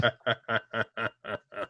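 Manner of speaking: an eerie, echoing, taunting laugh; mocking laugh. The Shadow mocks the trapped killer, eerie laugh.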